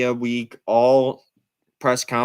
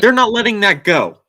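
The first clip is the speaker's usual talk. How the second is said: louder and higher, worked up; heated; 6 semitones higher, 7 dB louder than usual. Real, not acted. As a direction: let the outrage push the pitch up. Heated rant about the stage rush.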